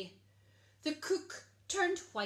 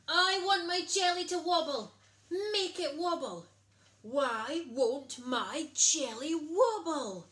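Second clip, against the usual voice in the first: much louder and higher whining demand; royal tantrum; 6 semitones higher, 9 dB louder than usual. A narrator's reading. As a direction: wail it like a spoilt child. Princess tantrum.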